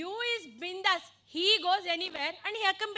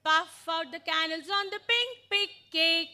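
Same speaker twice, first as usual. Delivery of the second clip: brisk, run together without gaps; faster demonstration. Shown as a demonstration. Teacher shows how the sentence really goes, faster. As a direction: chant it through at full pace. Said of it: "But it goes fast".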